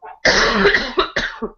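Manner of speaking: breathy high cough; sick rattling cough; hacking cough. Real, not acted. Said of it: "feels like I'm coughing up my guts".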